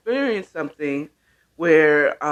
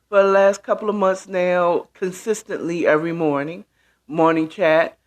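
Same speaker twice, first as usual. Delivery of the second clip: ordinary delivery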